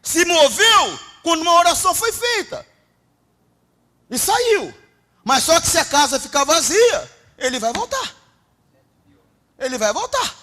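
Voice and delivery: loud, high-pitched preaching at full force; fiery preaching. Acted. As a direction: preach it hard to the congregation.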